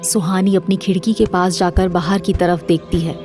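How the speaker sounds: normal talking voice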